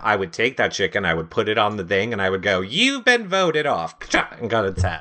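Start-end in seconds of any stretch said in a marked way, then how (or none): 2.6-4.0 higher, drawn-out mock host pronouncement; Jeff's elimination verdict. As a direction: pretend to announce an elimination, slowly. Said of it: "if I was Jeff".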